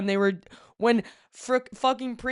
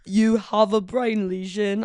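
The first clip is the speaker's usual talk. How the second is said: slow, mock British doctor voice; mock British accent delivering bad news; slower than usual. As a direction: put on a posh British accent, slowly. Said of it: "Someone going".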